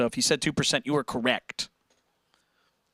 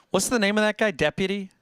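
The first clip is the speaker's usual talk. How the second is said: ordinary delivery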